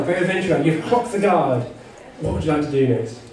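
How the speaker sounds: normal talking voice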